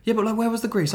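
high insistent question; suspicious girlfriend. Retold or imitated. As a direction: press him for the answer. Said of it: "She was like".

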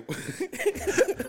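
squealing laugh, way up high; real uncontrollable laugh; high burst of laughter. Real, not acted. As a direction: break into a helpless high laugh. Laughing at own story.

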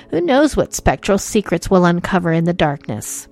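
normal talking voice

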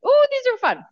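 high, fast and loud delight; delighted squeal. Real, not acted. Delight at crispy leaves.

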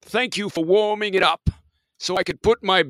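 high, loud mock-grand proclamation; pompous captain. Host claiming the captain's chair from co-host, pompous captain.

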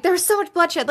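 high-pitched mock alarm; lightly acted freak-out. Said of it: "She's just freaked out".

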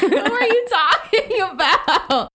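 high, slow, laughing disbelief; laughing bafflement. Retold or imitated. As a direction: drag it out while laughing. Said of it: "I was like".

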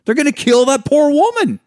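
high, loud, fretful movie quote; quick loud imitation of the movie line; quoting the movie. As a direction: blurt the line out high and alarmed. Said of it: "one of my favorite fucking moments of that movie".

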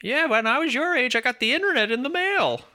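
put-on high, creaky little old lady. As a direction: play a grumpy granny remembering. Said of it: "replies in the old lady voice".

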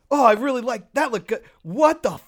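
high, loud mock outrage at the screen; exasperated yelling. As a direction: rant at a dumb scene, half joking. Retold exasperated reaction to movie.

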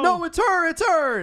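very high, frantic imitated cry; hammed-up desperate warning; acted panic. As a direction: squeal the alarm in mock terror. Acted panic at recognising the devil.

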